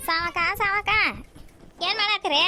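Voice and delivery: high, loud call to a neighbour at her door. Acted. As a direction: shout her name across the yard. Calling neighbour from outside.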